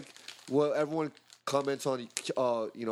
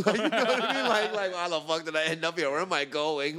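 lost and confused; 4 semitones higher, 3 dB louder than usual. Retold or imitated. Voicing Van Damme lost abroad.

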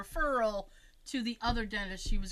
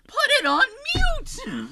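slow, high, louder with a laugh; exasperated scold; 13 semitones higher, 8 dB louder, slower than usual. Retold or imitated. Telling mom off in the retold story, laughing scold. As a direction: scold her, half laughing.

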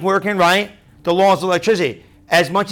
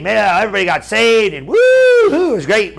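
mock-thrilled shout about a great revival meeting; hammed-up mission excitement; 2 semitones higher, 5 dB louder than usual. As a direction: gush like a thrilled returning missionary. Mocking glowing mission-trip stories.

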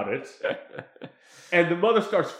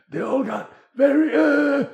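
raspy, strained singer's growl, louder; scratchy singer voice; 10 semitones higher, 5 dB louder than usual. Shown as a demonstration. Showing a friend how Italian singers sound, scratchy rasp. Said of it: "voices like that".

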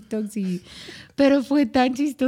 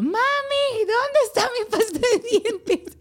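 mock child asking; 12 semitones higher than usual. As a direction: play the kid who can't find anything. Kid asking for toothpaste.

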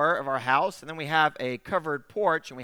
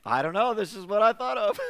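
laughing; 5 semitones higher, 3 dB louder than usual. Real, not acted.

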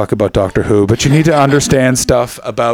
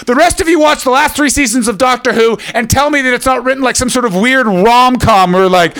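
ordinary talk

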